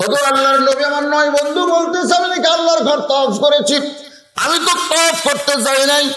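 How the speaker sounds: high, impassioned chanted appeal; acted distress, preached at high pitch; pleading sermon cry. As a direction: plead to the Prophet in a ringing high voice. Preacher voices plea to the Prophet.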